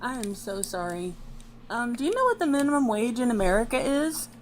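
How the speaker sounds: faint and quiet; speaking too softly into the mic; barely audible. Real, not acted. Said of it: "your voice is still quite quiet".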